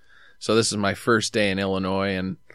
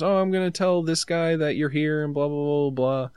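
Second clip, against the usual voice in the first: higher, lightly voiced manager; a hint of an upbeat store manager; casual mock quote; 7 semitones higher than usual. Voicing the manager.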